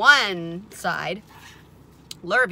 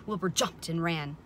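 usual speaking voice

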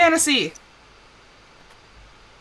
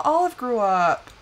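usual speaking voice

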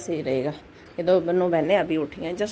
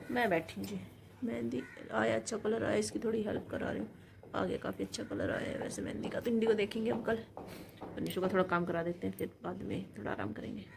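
soft near-whisper so as not to wake her; hushed talk; 12 dB quieter than usual. Real, not acted. Mother talks to viewers in a hush while her daughter sleeps.

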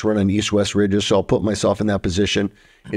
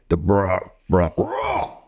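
imitated deer call; 2 semitones lower, much slower than usual. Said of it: "starts mimicking exactly what he was doing".

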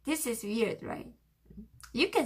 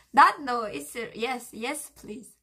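normal talking voice

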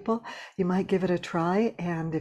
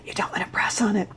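whisper to a friend; 4 semitones higher than usual. Shown as a demonstration. Voice teacher demonstrating the friendly whisper. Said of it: "That's one of the traps".

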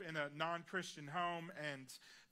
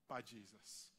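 hushed emphasis; 8 semitones lower, 9 dB quieter, slower than usual. Real, not acted. Repeated climax of the point.

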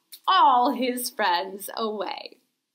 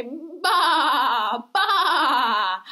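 sheep bleat; 4 dB louder than usual. Shown as a demonstration. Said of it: "Can you say".

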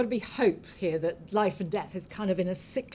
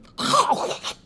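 loud, rough gobbling burst; devouring noise; 5 dB louder than usual. Acted. Ogre grandmother suddenly devours the grandchild, noisy gobble. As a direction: imitate a monster gulping its prey in one go.

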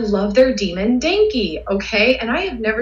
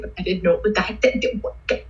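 breathless stammer; faster than usual. Retold or imitated. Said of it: "I couldn't talk".

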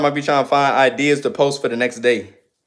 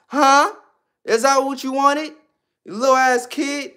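high, heated rant at the heckler; high-pitched angry rant; heated callout; 10 semitones higher than usual. Real, not acted. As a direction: go after him, voice up high. Berating a heckler on stream.

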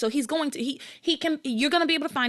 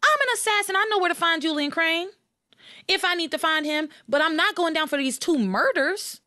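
excited assassin rant; 8 semitones higher than usual. Pretend. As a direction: argue it like you're the hitman yourself. Pretends to be the assassin.

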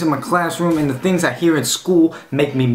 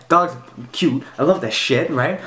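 ordinary talk, nothing marked

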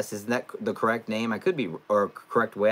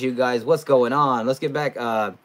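ordinary talk

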